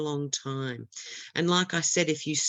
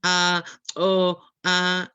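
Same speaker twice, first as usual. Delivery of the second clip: loud, halting hesitation noises; panicky stutter demo; 6 dB louder, slower than usual. Shown as a demonstration. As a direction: stammer out disconnected fillers, mimicking a stuck candidate. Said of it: "don't stutter by going".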